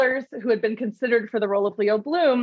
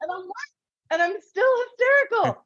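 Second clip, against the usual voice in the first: high, strained, panicked complaint; imitating Leo Bloom's shrill meltdown; mock hysterical wail; 10 semitones higher than usual. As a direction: whine it like a slapped, frantic man. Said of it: "I'm hysterical".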